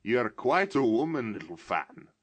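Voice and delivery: exclaimed. A narrator's reading. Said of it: "exclaimed the boy".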